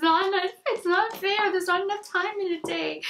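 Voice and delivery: high, fast playful complaint; hammed-up protest; mock whine. Real, not acted. Playful complaint about no time.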